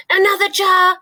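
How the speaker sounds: high, loud, eager giant's shout; excited cry. A narrator's reading. Giant catching a dream, crying out in excitement. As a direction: call out in thrilled triumph. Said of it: "he cried".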